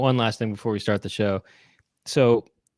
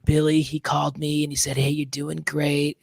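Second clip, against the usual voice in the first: Whoopi Goldberg impression; 3 semitones higher than usual. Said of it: "She actually sounds like this".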